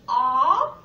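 ah said loudly, short o as in octopus; short o sound. Shown as a demonstration.

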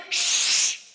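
drawn-out hush to a crowd; playful "shh". Acted. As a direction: shush everybody. Hushing the imagined crowd.

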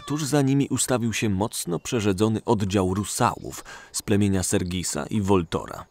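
usual speaking voice

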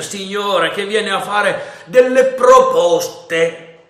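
high, loud and slow announcing; rebel sailor voice. A narrator's reading. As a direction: drawl out the offer. The other rebel answers.

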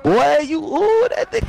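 excited squeal. Real, not acted. Reacting to a great play.

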